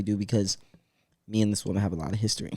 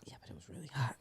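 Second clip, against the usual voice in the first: soft breathy whisper; 5 semitones higher, 16 dB quieter, faster than usual. Acted. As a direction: whisper it like a secret. Whispered aside about the heat.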